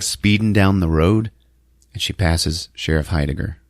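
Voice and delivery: normal talking voice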